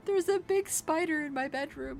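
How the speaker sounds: high, loud frightened wail; mock panic. The neighbor tells the couple about the spider, high mock panic. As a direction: squeal it out in fake terror.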